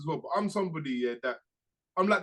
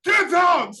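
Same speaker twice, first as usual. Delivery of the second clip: yelled get out at full volume; teacher yelling at a pupil; angry shout; 11 semitones higher, 10 dB louder than usual. Retold or imitated. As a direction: bark it like a furious teacher. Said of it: "So she's like".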